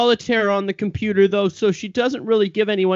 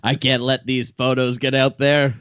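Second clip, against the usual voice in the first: low, villainous billionaire; 8 semitones lower than usual. Acted. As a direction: growl it like a cartoon villain. Bill Gates villain speaking.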